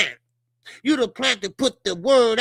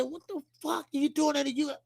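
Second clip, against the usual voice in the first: mock shocked; 8 semitones higher, 5 dB quieter than usual. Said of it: "I said".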